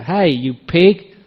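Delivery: slow, drawn-out mock yell across the field; a farmer's slow, stretched-out shout. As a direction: call it out long and slow like a farmer at the gate. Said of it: "just shouted out".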